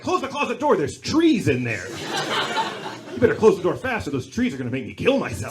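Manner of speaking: loud, comic panicked warning; mock panic. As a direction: shout it like you fear what's in the closet. Panicked retold order after horror film.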